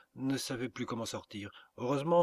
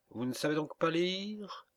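higher-pitched, indignant servant's question; haughty rebuke; 6 semitones higher than usual. A narrator's reading. Servant to a caller who missed the sign, haughty rebuke. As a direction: scold the ignorant caller from on high.

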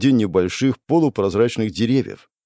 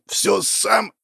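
high, strained, rasping words; choked wheeze; 11 semitones higher than usual. A narrator's reading. Hero pinned by the throat, high choked wheeze. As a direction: squeeze it out with no air left. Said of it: "прохрипел я в ответ".

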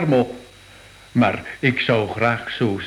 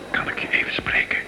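urgent hushed whisper; 5 dB quieter, faster than usual. Acted. Curator pulling his colleague aside, secretive whisper. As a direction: whisper it so no one overhears.

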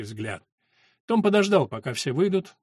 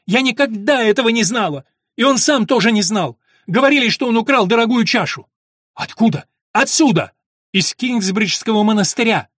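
loud, high, distraught outburst; heated exchange in raised, high voices; shouting; 9 semitones higher, 10 dB louder than usual. A narrator's reading. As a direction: cry it out at full voice. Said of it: "закричала мать".

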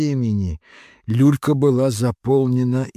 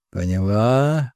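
serious; 6 semitones lower than usual. A narrator's reading. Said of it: "серьёзно ответил инквизитор".

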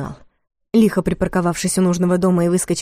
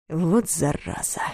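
muttered through teeth; 7 dB quieter, slower than usual. A narrator's reading. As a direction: spit it out quietly. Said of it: "процедил Соболев".

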